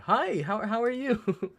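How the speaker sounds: squealed high, loud greeting; light up and call out her name; thrilled to see a friend in chat; excited greeting. Real, not acted. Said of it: "I got really excited".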